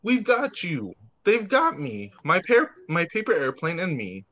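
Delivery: ordinary delivery, nothing marked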